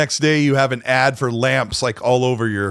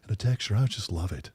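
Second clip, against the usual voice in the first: soft voice; 6 semitones lower, 7 dB quieter than usual. Pretend. Joins the ASMR pudding bit.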